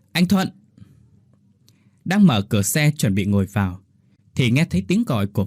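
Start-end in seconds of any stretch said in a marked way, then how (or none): 0.1-0.5 high sudden call of his name; calling sharply. A narrator's reading. Wife calls out to her husband on the street, sharp call. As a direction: snap his name out to stop him. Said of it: "gọi giật giọng".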